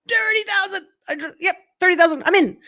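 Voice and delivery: high, loud triumphant shout of the bid; excited bid. Real, not acted. Bidder shouting her winning bid across the room, excited high shout. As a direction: throw the bid out, fired up.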